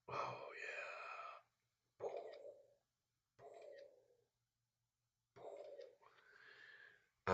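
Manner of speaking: soft whispered oh yeah; quiet at night. Real, not acted. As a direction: whisper so the wife sleeps on. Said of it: "trying to be quiet".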